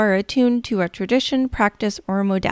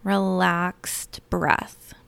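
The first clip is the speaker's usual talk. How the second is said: guided meditation. Acted. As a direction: lull them gently into calm.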